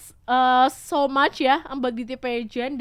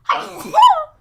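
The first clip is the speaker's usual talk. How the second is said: sneezing; 14 dB louder than usual. Real, not acted. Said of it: "So-, sorry".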